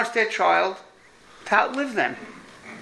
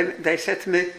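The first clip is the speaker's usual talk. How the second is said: ordinary talk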